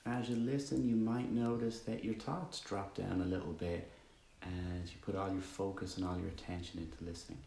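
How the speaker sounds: calm, even meditation voice with long pauses; guided meditation. Acted. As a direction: guide listeners gently into stillness, leaving long silences.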